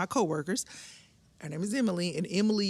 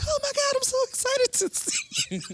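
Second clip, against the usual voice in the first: much higher thrilled squeal; squealing excitement. Retold or imitated. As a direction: gush like a starstruck fan. Excited greeting.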